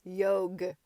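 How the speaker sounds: hearty, loud shout of "Yog"; jovial loud call of "Yog"; good-humored shout. A narrator's reading. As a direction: bark out "Yog" cheerfully. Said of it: "shouted Morward good-humoredly".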